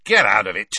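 short, loud irritated order; sharp, loud dismissal; snapped. A narrator's reading. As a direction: snap it out to dismiss him. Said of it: "Harry snapped at him".

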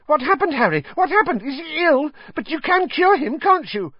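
put-on high, eager boy's voice; breathless young fan, all questions; excited Colin. Colin excitedly fussing over Ron.

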